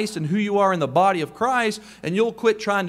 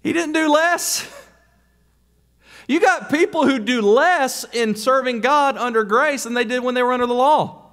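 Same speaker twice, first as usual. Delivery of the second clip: raised, insistent pulpit voice; preaching at full force; 6 semitones higher, 4 dB louder than usual. Acted. Preacher scolding lazy believers, fervent and raised. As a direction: drive it home to the congregation.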